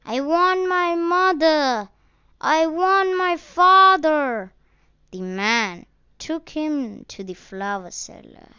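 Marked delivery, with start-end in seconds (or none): 0.0-4.5 put-on high, louder child's weepy plea; lost boy weeping to a stranger. A narrator's reading. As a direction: voice of a child in tears. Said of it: "continued sobbing".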